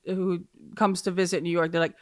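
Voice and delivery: usual speaking voice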